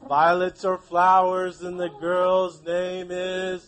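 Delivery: slow, drawn-out sing-song lead-in; sing-song cue for the class; slow prompting. Shown as a demonstration. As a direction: stretch it so the kids finish the sentence. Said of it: "teacher prompts class completion".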